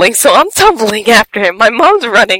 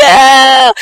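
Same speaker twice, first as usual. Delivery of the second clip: mock disgusted, drawn-out "ew"; grossed-out squeal; 5 semitones higher, 5 dB louder than usual. Squealed disgust at wet foot.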